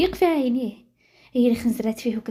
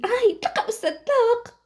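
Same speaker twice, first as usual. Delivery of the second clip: high-pitched pained yelp of protest; 11 semitones higher, slower than usual. Acted. Student begging the professor to let go of her hair, pained cry.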